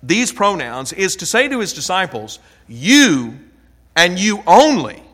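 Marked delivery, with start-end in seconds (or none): 2.7-5.0 "You" and "you only" hit hard and loud; emphatic you. Shown as a demonstration. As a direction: lean hard on "You", then punch "you only" louder. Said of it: "by putting a special emphasis".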